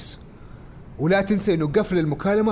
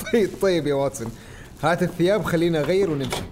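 ordinary delivery

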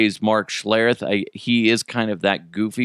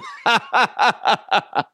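mock laugh; 8 semitones higher than usual. Mocking the announcer.

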